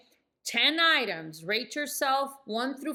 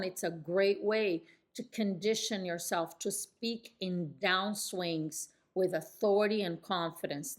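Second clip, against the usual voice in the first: ordinary talk, nothing marked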